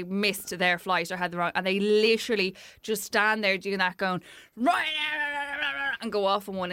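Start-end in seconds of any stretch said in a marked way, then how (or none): 4.6-5.9 mock screaming passenger. Passenger who missed a flight ranting at staff, mock screaming. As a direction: imitate an irate customer screeching. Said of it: "people will come screaming at you".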